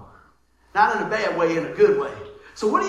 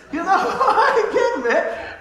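laughing; 11 semitones higher, 8 dB louder, slower than usual. Real, not acted. Still laughing at the story.

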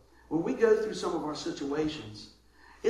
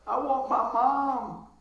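put-on slow, higher, whiny little-kid voice; scared little kid; 6 semitones higher, 4 dB louder, much slower than usual. Preacher imagining himself on the water, whining like a child. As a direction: whimper like a frightened child. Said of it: "I'd be like".

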